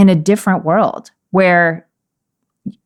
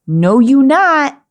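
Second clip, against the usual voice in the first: firm refusal; 6 semitones higher, much slower than usual. Shown as a demonstration. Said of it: "he's about to say".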